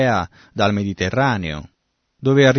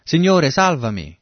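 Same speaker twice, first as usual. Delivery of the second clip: higher-pitched plea of a sinking man; acted cry for help; 7 semitones higher, 4 dB louder than usual. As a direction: call out urgently to be saved. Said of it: "ha iniziato ad affondare". (grido recitato di aiuto)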